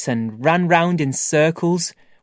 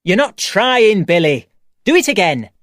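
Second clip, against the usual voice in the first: shouting teacher; 4 semitones higher, 5 dB louder than usual. Acted. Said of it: "Miss was shouting at me".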